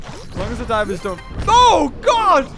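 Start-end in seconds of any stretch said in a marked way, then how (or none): 1.5-2.5 high loud startled yell; real startled shout. Real, not acted. Gamer startled by a sudden diver, loud shout. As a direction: jump at the scare.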